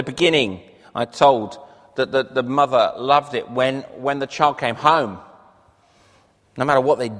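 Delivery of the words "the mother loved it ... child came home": ordinary talk, nothing marked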